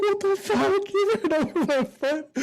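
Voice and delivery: high-pitched imitation of a freaked-out brother; mock freak-out. Imitating little brother after car rolled over his foot, mock freak-out. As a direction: squeal it in comic panic. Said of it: "He's freaking out".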